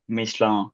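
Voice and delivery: "Michelin" said the French way; "Michelin" with French sounds, meesh-LAN; French pronunciation. Real, not acted. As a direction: say "Michelin" as a French speaker would. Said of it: "Gotta say it in French".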